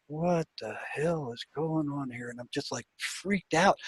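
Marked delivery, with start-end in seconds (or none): 0.1-2.3 slow, much softer muttered question; quiet, slow, bewildered aside; hushed disbelief. Retold or imitated. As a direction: say it under your breath, stunned. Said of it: "And I'm like".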